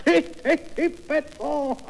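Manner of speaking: high, drawn-out gleeful cry into laughter; extase comique. Acted. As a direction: squeal it slowly in hammed-up delight. Même cri, avec la belle-mère. (cri de ravissement surjoué, aigu)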